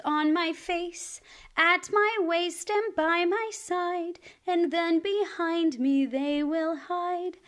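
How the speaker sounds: put-on lilting children's rhyme; sing-song rhyme. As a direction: chant it brightly for little kids. Action rhyme for kids.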